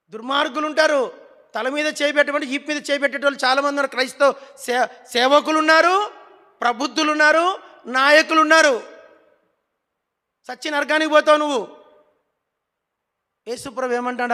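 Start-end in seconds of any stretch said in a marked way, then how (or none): 0.1-11.8 loud, driving pulpit voice, hammering each line; fiery preaching. Acted. Pastor rebuking the church's thieves, fiery preaching. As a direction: preach it hard at full force. Pastor condemns wicked leaders loudly.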